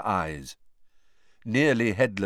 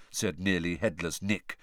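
usual speaking voice

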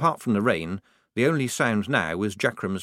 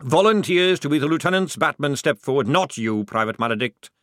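loud parade order, pitched up then settling; snapped; 6 semitones higher, 6 dB louder than usual. A narrator's reading. Sergeant calling for a volunteer, snapping the order. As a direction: snap out a sergeant's command. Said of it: "snapped the sergeant".

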